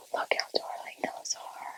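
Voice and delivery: barely audible, breath-only whisper; very soft whisper. Real, not acted. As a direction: whisper it adoringly. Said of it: "I don't know why I'm whispering".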